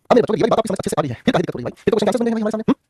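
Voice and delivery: normal talking voice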